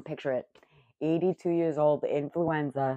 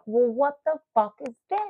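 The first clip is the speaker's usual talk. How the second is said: retold shock; 8 semitones higher than usual. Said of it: "I'm like".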